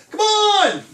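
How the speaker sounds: high drawn-out shout up to a window; loud, high, stretched-out yell; calling out to a friend. Retold or imitated. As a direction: holler for a buddy to come out and play. Buddies call up to him in window.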